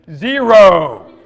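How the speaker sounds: put-on loud laughing chorus of kids answering; kids in unison. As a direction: shout it like kids all at once, laughing.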